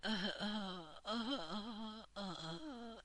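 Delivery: breathy panting fading away; tired engine panting, sounding like Grandma; panting aeroplane. Acted. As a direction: pant like a tired old engine. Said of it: "the aeroplane was panting".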